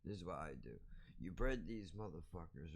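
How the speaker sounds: ordinary talk, nothing marked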